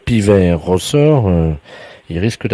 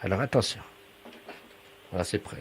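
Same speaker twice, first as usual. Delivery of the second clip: hushed; 16 dB quieter, faster than usual. Pretend. Researcher readies caller for a shy bird, hushed. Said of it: "Vous ne parlez pas très fort".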